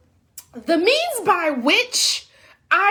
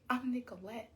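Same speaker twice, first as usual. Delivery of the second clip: much softer, self-important murmur; inner voice; 4 semitones lower, 9 dB quieter than usual. Retold or imitated. Thinking at the receptionist, much softer. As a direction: say it under your breath. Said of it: "In my head".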